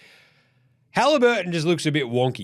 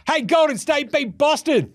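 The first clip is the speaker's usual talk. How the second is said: pitched way up, louder and slower; excited announcement; 12 semitones higher, 4 dB louder than usual. Real, not acted. Podcaster bursting out with the Warriors result, excited. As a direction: burst in with the news.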